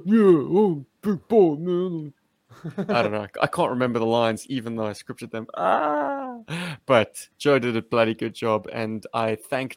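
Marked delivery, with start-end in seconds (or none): 0.1-2.1 loud, high, slurred action-star impression; Sly Stallone. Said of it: "Sly Stallone impersonation". 5.6-6.4 long, high-pitched sustained "ah"; mock frustrated cry. Mock frustration at forgotten lines.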